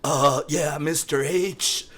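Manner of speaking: high, slow laid-back Cali drawl; mock California drawl to a teacher; Californian student. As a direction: drag it out like a chill Californian kid. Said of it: "he's like".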